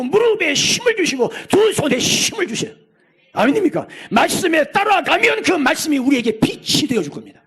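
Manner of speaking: high, loud fervent preaching cry; preaching at full force. Real, not acted. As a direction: shout it out as a rousing call. (높고 크게 외치는 설교)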